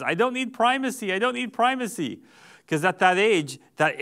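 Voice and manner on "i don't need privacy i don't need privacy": put-on high toddler voice, insisting; high child's voice, repeating the plea; little boy. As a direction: call out like a small boy who doesn't want to be left alone. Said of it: "He says".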